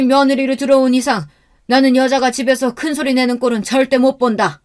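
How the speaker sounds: high, angry father-in-law tirade. Acted. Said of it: "목소리를 높이시니". (목소리를 높여 호통치는 시아버지)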